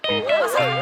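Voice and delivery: loud, high order to sit; sharp command. Acted. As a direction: bark the word out.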